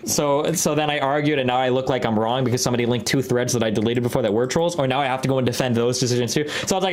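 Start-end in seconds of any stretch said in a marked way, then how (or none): none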